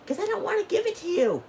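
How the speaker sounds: very high, hammed-up parent voice; sing-song brush-off of the kid; imitating mom refusing her son on a whim; mock parent refusal. Parent refusing child at store.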